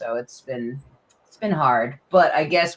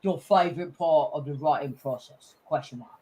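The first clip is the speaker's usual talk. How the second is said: put-on a light British accent, softer, reading out the question; 3 semitones lower, 5 dB quieter than usual. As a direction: read the chat question in a mild British accent. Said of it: "Why does he have a British accent?".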